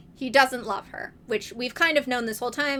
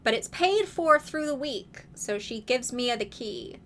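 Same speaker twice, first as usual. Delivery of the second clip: normal talking voice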